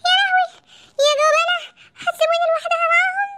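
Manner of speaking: panicked high yell at the runaways; shrill shriek. Acted. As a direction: yell it high and loud, outraged. Wife shrieks at cowards.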